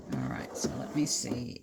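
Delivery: whispering. Real, not acted. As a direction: whisper it under your breath while working. Muttering to herself while pinning.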